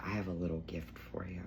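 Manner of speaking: breathy secret whisper, soft and hushed; hushed whisper of a friend handing over a gift. Retold or imitated. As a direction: whisper it like a little surprise. Retelling friend's quiet gift reveal.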